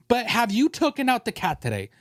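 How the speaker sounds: normal talking voice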